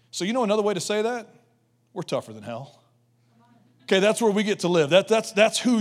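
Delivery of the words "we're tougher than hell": much lower, softer, pointed; low punchline. Real, not acted. Preacher telling the church they're tougher than hell, low and quiet. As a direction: drop it low and firm.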